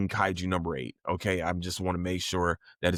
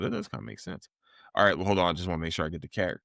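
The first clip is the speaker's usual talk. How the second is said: ordinary delivery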